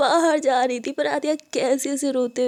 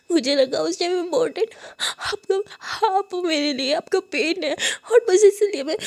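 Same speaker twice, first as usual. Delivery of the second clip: high, tearful, gasping outpour; sobbing; 4 semitones higher than usual. Acted. Hurt student explaining why she didn't write, sobbing. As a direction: cry out every grievance, catching your breath. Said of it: "मुस्कान रोते हुए कहती".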